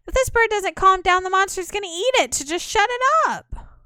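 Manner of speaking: high and loud, pleading; hiss-free panicked urging to silence the bird; high-pitched frightened voice; frantic character. Acted.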